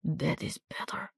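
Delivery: soft and slow, barely there; resigned, fading voice; wounded soldier telling Miranda death is better; weak whisper. A narrator's reading.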